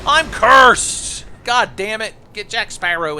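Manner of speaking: high, loud cry of outrage; frustrated shout. Real, not acted. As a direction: yell it out in dismay. Hit by curse in fight.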